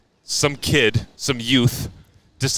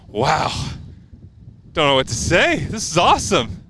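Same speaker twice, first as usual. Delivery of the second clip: imitating a winner's amazed delight, pitch climbing; happily surprised; 4 semitones higher than usual. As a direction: play stunned and thrilled, pausing after the first word. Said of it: "so happy and surprised by everything".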